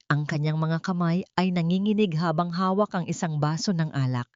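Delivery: plain reading voice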